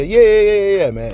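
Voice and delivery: high, loud burst of yeahs; eager yeahs. Acted. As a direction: blurt out an eager yes, yes. Eager reply to imagined viewer.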